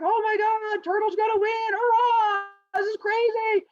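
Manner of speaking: very high, hammed-up cheering; frenzied yelling crowd. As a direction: squeal like animals going nuts. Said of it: "the animals are yelling so much".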